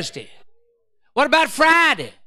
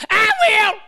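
eager demon; 9 semitones higher than usual. Acted. Demon volunteering.

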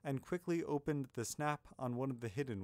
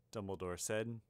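usual speaking voice

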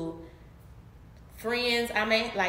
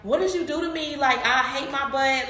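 higher, louder mock complaint; retold distress; 5 semitones higher, 4 dB louder than usual. Upset patient confronting her doctor, retold high complaint. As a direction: wail the accusation in mock upset.

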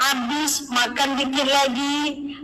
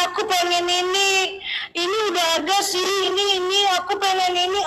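higher-pitched, hurried wanting; acted-out whiny wishing, pitched up; mock craving; 6 semitones higher than usual. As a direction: imitate someone greedily wanting everything. Mimics someone endlessly wanting things.

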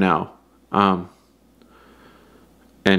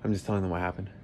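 hushed explaining whisper; 11 dB quieter, much faster than usual. Real, not acted. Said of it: "But why are you whispering?".

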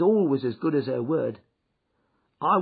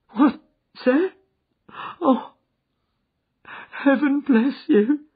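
high, loud tearful blessing; tearful thanks; 10 semitones higher, 7 dB louder, slower than usual. A narrator's reading. Freed thief blessing Holmes, high and tearful. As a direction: thank him through tears.

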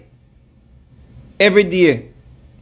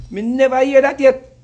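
ordinary delivery

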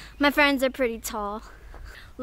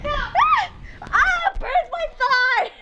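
pained yelp; 4 dB louder, slower than usual. Real, not acted. Friction burn on the slide.

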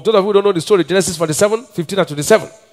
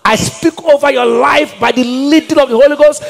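full-force preaching; 7 semitones higher than usual. Acted. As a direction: preach it over them with rising fire.

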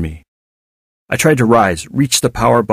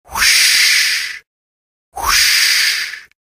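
whispered whoosh. A narrator's reading. Waves sound.

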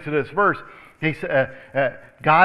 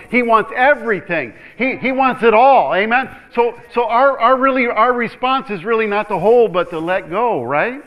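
loud, driving preacher's build; full-force sermon delivery; preaching at full force; 6 semitones higher, 9 dB louder than usual. Real, not acted. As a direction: press it home to the congregation.

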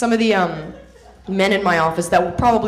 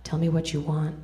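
sultry whisper; 5 semitones lower, 9 dB quieter than usual. Retold or imitated. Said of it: "I whispered in his ear".

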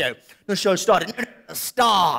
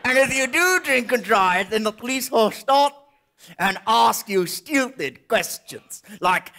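ordinary delivery

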